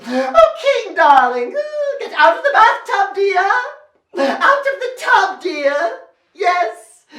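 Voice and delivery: high, hammed-up royal pleading; coaxing queen. Queen to king in the tub, high coaxing. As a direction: coax a husband in a lofty queenly voice.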